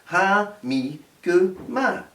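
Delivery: "ha mi gu ma" slowly, each syllable separate, rising at "ma"; teacher spelling out "ha mi gu ma" slowly; slow Gaelic demo. Shown as a demonstration. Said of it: "just one syllable in each word".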